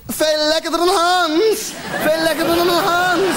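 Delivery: high, slow hammed-up cry of pleasure; high, slow mock ecstasy cry. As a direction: ham up an imagined lover's cry. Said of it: "En ze schreeuwt".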